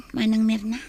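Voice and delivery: ordinary talk, nothing marked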